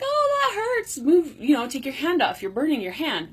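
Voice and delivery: high urgent yelp that starts way up then settles; mock alarm from the body, pitched high on "Oh"; body's warning voice. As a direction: play your body shouting a quick warning. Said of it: "your body's like".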